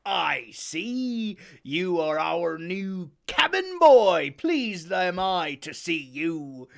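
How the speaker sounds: put-on slow, hearty old sailor; Silver welcoming. Silver welcomes new cabin boy, slow and hearty. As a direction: draw it out warmly.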